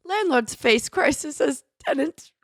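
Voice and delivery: British headline read, pitched high; amateur go at a British accent; attempted British accent. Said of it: "you kinda did it".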